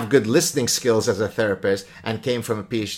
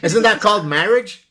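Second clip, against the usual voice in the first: loud, high, playful jab; teasing quip; 10 semitones higher, 10 dB louder than usual. Real, not acted. Host teasing guest about marriage, loud and high. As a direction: tease her with a punchline.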